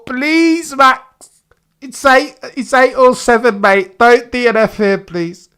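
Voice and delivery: high, loud begging; desperate pleading. Real, not acted. Fan begging Max not to crash out. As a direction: plead with him, voice strained high.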